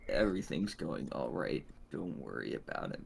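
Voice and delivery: soft unvoiced whisper. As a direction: breathe it out in a whisper. Whispered signal reply.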